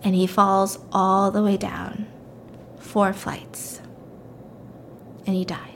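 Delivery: slow, hushed whisper at the climax; dramatic whisper. A narrator's reading. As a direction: drop to a whisper for the fall.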